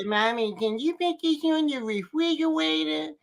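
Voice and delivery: a hint of a small child asking. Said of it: "and said".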